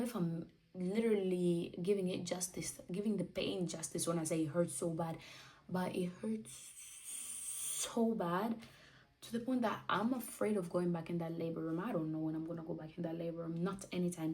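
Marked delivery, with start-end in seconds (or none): 5.9-8.6 "so" drawn out with a long hissed s; slow down and stretch "so" for emphasis; emphatic SO. Real, not acted. Mother stressing labour pain, "so" stretched long. Stresses how much birth hurt.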